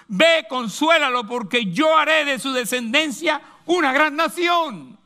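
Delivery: angel of the Lord. Acted. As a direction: speak as the angel comforting the mother, slowly. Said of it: "El ángel del Señor".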